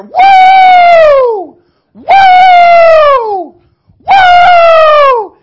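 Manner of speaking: long high whooping cries, very loud; imitated joyful shout. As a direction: whoop it out like an old preacher on fire. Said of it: "he'd jump up off the chair".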